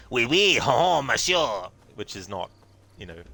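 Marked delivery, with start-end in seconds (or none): 0.1-1.7 over-the-top French accent, higher and louder; exaggerated French accent. Shown as a demonstration. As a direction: ham up a cartoon French accent. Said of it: "my version of an exaggerated French accent".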